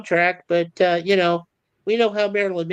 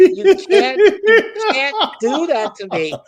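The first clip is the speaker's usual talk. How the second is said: louder, higher, laughing through it; laughing protest; 7 semitones higher, 6 dB louder, slower than usual. Real, not acted. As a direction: plead through real laughter, much louder. Protesting friend's song, laughing.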